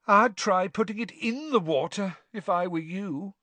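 put-on high, airy ghost girl; high girlish ghost voice; Myrtle's ghostly voice. A narrator's reading. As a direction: speak like a glum schoolgirl ghost.

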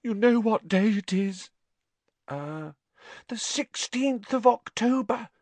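high, tearful melodrama; distraught, theatrical grief; tragic. A narrator's reading. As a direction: lament in a high wailing voice. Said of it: "said Lavender tragically".

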